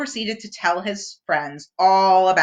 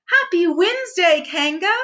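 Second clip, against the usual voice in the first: Pooh voice; 9 semitones higher than usual. A narrator's reading. Pooh greeting cheerfully.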